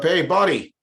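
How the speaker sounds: stuck and hollering upward, pleading; calling up for help. Retold or imitated. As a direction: hail a friend walking past above. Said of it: "He goes up".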